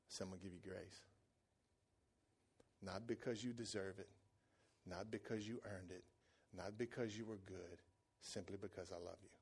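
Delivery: very soft, low fatherly reassurance; tender forgiveness. Retold or imitated. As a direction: gently grant mercy, hushed and low. Father tenderly forgives his son.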